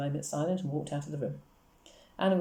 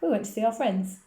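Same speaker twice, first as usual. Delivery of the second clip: put-on higher, projected child's answer; a light, lively call; called back; 6 semitones higher, 4 dB louder than usual. A narrator's reading. Schoolgirl answering her friend, called back. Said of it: "Anna called back".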